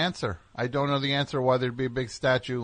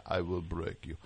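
low, quiet Russian boxer voice; deep menacing boxer line; Drago impression; 8 semitones lower, 11 dB quieter than usual.

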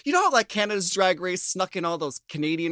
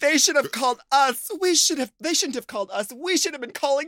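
higher-pitched imitation of an indignant scolding; playfully indignant retelling; mock scolding; 8 semitones higher than usual. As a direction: act out her complaint, pitched up. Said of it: "she is, like, basically scolding the legislation".

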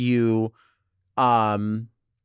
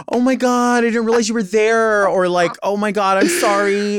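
high, loud flustered apology; embarrassed apology; 9 semitones higher, 6 dB louder than usual. Retold or imitated. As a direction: blurt it out, mortified and loud. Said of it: "they're like".

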